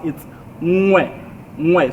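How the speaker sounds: "ngwe" with the ng sound from the back of the tongue; correct pronunciation. Shown as a demonstration. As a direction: say "ngwe" with a nasal ng onset. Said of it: "And that's not how it's, um, pronounced".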